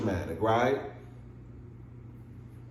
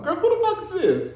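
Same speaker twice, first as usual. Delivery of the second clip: much higher, shocked "who the fuck is this"; alarmed. Retold or imitated. Answering an unknown caller, much higher and alarmed. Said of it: "I won't be like".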